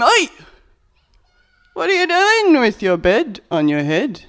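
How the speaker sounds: loud, high, indignant challenge; officious shout. Acted. Religious authorities shouting at the man with the bed. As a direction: bark at him like a jobsworth. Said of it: "the religious authorities see him".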